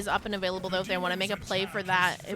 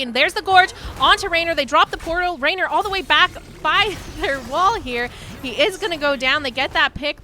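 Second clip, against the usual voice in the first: excited play-by-play; 5 semitones higher, 5 dB louder than usual. Real, not acted. Caster hypes a teamfight.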